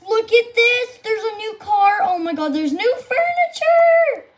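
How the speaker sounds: high excited calls rising to a squeal. Real, not acted. Streamer spots new car and furniture, excited. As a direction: build to a squeal of discovery.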